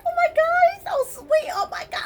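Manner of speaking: squealing high smitten gush. Retold or imitated. Woman mimicking her smitten self over the sweet new man. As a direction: gush like a giddy girl with a crush. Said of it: "I'm all like".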